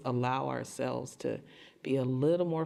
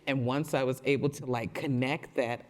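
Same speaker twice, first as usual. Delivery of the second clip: ordinary delivery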